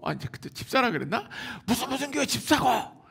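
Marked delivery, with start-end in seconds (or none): 1.7-3.0 scoffing professor. Retold or imitated. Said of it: "막 이러는 거예요".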